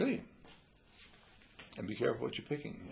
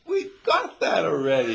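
mock letdown; 6 semitones higher, 12 dB louder than usual.

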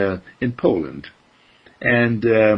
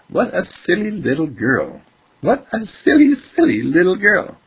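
high-pitched exclaiming, building louder; acted tittering onlookers; amused exclamation; 10 semitones higher, 5 dB louder than usual. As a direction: crow it in high-pitched amazement, louder on the repeat. People exclaiming at the girl.

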